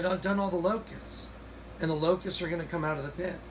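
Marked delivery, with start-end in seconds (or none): none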